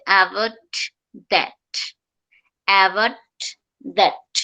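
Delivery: অ্যাভয়েড দ্যাট with each final T held, unreleased. Shown as a demonstration. Teacher demonstrating the tough healthy T, held T. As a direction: stop the T in অ্যাভয়েড, tongue stays up, then দ্যাট. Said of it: "এটা নিচে নামবে না".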